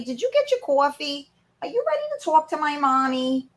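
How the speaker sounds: high-pitched voice for the dog; voicing the pet.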